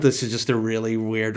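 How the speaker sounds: ordinary delivery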